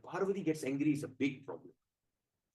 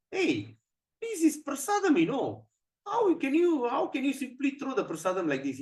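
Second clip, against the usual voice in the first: high-pitched imitation of angry protesters; indignant crowd; 10 semitones higher, 4 dB louder than usual. Angry people protesting thrown prasadam, indignant. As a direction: act out scandalized, angry people. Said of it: "People get very angry".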